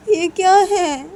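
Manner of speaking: delighted surprise. Acted. Delighted surprise at baby's kiss.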